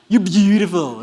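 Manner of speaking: loud, exaggerated compliment; mock admiring exclamation. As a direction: belt out the compliment in jest. Demonstrates ugly-sounding English word.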